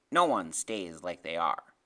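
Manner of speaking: low, slow, loud pronouncement; knowing lecture. Acted. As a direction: lay it down like a little professor. Freak lectures his slower friend.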